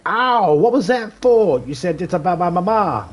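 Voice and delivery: high, loud pained outcry in a put-on voice; hurt yelp. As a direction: whine like you just got hit. Acted victim after being smacked.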